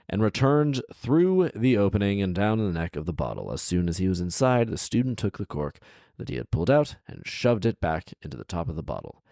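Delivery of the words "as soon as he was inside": ordinary delivery, nothing marked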